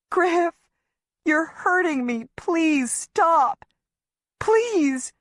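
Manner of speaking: high, frightened plea as he drags her; frightened begging. Acted. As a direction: beg him to stop, near tears. Dragged into forest, crying.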